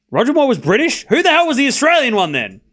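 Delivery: high-pitched, incredulous outburst; loud disbelief. Real, not acted. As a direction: blurt it out, baffled and indignant. Baffled at being corrected on actor.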